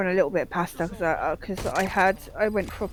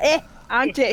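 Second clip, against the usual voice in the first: put-on light South African accent; 5 semitones higher, 6 dB louder than usual. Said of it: "'Cause he's South African".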